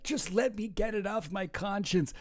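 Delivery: hammed-up confession, pitched high; pleading Trump. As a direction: beg in a high, put-on tearful whine. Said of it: "It's Trump is like, every day, he's like".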